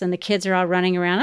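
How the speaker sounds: ordinary talk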